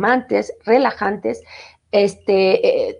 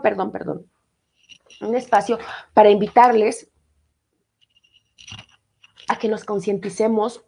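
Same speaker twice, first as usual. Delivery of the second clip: ordinary talk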